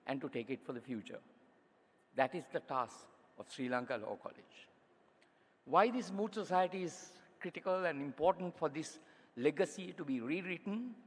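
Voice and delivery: usual speaking voice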